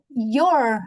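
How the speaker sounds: normal talking voice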